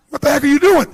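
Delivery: loud, high scolding yell; shocked, indignant shout; brain yelling. Retold or imitated. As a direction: yell like your own brain caught you out. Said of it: "your brain goes".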